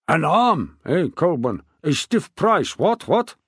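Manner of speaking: put-on loud, high, bluff old king; a jovial, blustering royal voice. A narrator's reading. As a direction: boom it out like a hearty old monarch. King speaks to Colborne.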